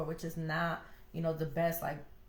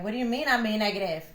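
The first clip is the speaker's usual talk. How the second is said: defensive; 5 dB louder, faster than usual. Retold or imitated. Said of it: "I used to be like".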